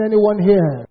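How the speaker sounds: loud, rising prayer declaration; forceful prayer. Real, not acted. Preacher praying over the congregation, loud and forceful. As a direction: pray it out with full force.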